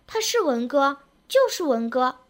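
mildly insistent protest; struggling cry. A narrator's reading. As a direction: insist stubbornly, without really shouting. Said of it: "一边挣扎一边叫道".

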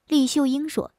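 usual speaking voice

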